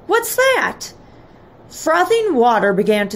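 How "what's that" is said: high-pitched startled question; high, worried exclamation; alarmed cry. A narrator's reading. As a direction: ask it in storybook alarm. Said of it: "Mother cried".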